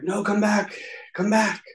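high, pleading cry, imitating sobbing; retold wail begging them to return; desperate crying plea. Dreamer begs his dead friends to come back, high pleading cry. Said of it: "screaming and bawling, crying in that dream".

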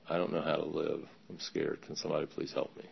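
low, soft confession; subdued plea. Retold or imitated. Newcomer speaking up at his first meeting, subdued plea. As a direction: ask for help quietly, voice dropped.

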